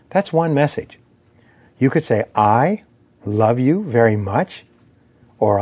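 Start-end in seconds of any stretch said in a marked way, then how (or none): 2.3-4.7 a stressed "I" set apart by a pause, then slow to a questioning rise. Shown as a demonstration. Speaker showing how emphasis changes meaning, stress on "I". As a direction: hit "I", pause, then lift the end. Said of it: "put the intonation or the emphasis on the words".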